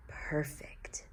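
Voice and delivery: perfect whispered softly. A narrator's reading. Narrator hushing the last word, whispered. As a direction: let the word fade to a whisper.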